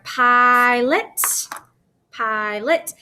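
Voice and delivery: "pilot" with a held pie, then lot; long I, split syllables. Shown as a demonstration. Teacher showing an open syllable to pupils, drawn out. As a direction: stretch the first syllable of "pilot", twice over. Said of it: "that I says its name".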